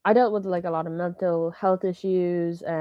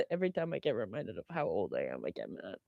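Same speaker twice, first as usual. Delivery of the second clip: muttered aside; 12 semitones lower, 14 dB quieter, faster than usual. Real, not acted. Mumbled grumbling aside.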